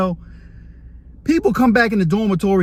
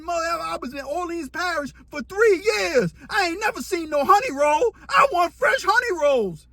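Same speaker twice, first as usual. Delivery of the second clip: mock whiny complaint; 8 semitones higher than usual. Cellmate whining about honey rolls.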